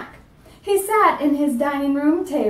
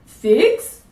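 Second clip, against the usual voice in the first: sharp, loud, rising Figs?; angry; 5 semitones higher, 5 dB louder than usual. Acted. Dentist angrily scoffing at the figs as payment. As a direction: bark it out in disbelief. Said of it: "he said angrily".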